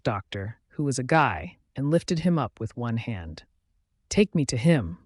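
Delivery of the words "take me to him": plain reading voice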